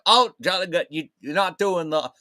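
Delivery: annoyed British viewer in a clumsy fake British accent; mock British accent. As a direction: play a scolding Brit in a bad accent. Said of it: "See, I'm butchering it".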